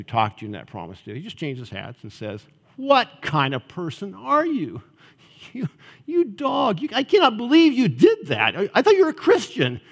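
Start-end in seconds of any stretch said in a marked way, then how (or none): 2.8-9.8 accusing devil. Acted. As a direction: berate the listener as the accuser. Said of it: "he just begins accusing you".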